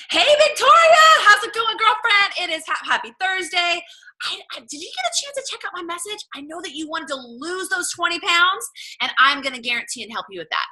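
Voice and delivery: high, loud and sunny; bubbly follow-up message. Shown as a demonstration. Coach follows up on a silent client with a bubbly, high voice message. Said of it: "I send a voice message".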